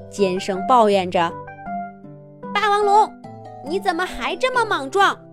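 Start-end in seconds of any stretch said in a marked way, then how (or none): 2.5-5.2 high squeaky grumbling; shrill complaint. Acted. (尖声抱怨)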